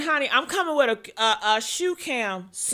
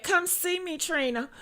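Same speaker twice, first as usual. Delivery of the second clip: softer, sing-song sarcastic read; mock-sweet repeat of a phony post; mocking fake sweetness; 6 semitones higher, 5 dB quieter than usual. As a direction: sneer at the fake invite. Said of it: "You know this shit is fake".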